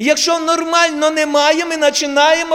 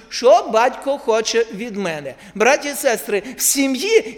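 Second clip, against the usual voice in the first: normal talking voice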